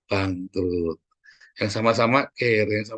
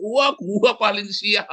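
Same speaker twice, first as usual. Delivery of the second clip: high, very slow proud lament; proud of misfortune; 9 semitones higher, much slower than usual. Retold or imitated. At a gathering someone claims the worst luck, in a high drawn-out boast. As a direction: savour being the unluckiest.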